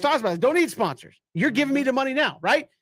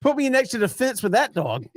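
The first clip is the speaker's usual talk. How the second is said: usual speaking voice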